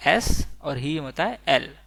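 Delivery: ordinary delivery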